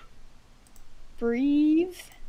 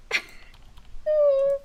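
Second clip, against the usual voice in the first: high laugh; 4 dB louder than usual. Real, not acted. Laughs at doing voices.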